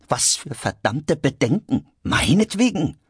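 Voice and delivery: high-pitched irritated retort; exasperated, pitched well up; indignant outburst. Acted. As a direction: snap it out in rising indignation. Quarrel, George indignant in audiobook.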